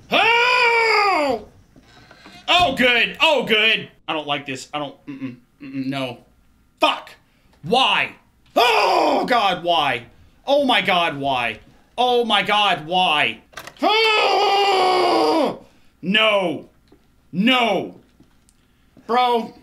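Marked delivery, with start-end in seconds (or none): 0.1-1.5 high scared shout; startled scream. Real, not acted. Gamer startled by a jump scare, screaming. 6.8-13.4 loud panicked cry; scared shout. Real, not acted. Gamer frightened by a monster, crying out loud. 13.8-15.6 high scared shout; frightened scream. Real, not acted. Gamer frightened by a monster, screaming.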